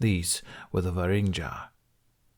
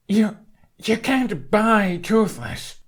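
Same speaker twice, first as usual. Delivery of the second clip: much higher, slow, fury breaking through; furious; 13 semitones higher, 4 dB louder, much slower than usual. A narrator's reading. Hiccup refuses to sell his dragon, in furious outrage. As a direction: let the outrage burst out slowly. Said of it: "A fury erupted within Hiccup".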